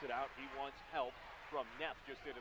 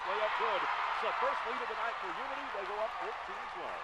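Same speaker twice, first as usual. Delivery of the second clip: louder, higher call of the go-ahead layup; excited call; 6 semitones higher, 9 dB louder than usual. Real, not acted. Announcer calling Unity's first lead of the night, excited.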